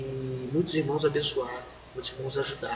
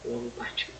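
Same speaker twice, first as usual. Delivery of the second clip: soft grave whisper; 6 dB quieter than usual. Real, not acted. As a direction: drop to a whisper for the death. Death announced, whispered. (sussurrado, anunciando a morte)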